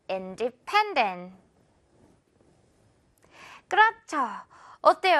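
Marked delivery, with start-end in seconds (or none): 0.1-1.4 independent with pen hit hard, very slow; slow, lean on pen; stress on pen. Shown as a demonstration. Teacher modelling the stressed third syllable, slow. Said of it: "One more time".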